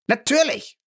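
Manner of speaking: high, sharp shout of indignation; yelling at the old man. A narrator's reading. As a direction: fling the word back at him. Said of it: "schrie Lacey ihn an".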